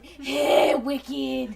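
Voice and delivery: loud, hammed-up Boston "wicked"; a loud mock Boston "Hey, wicked"; Boston accent. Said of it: "it's a Boston".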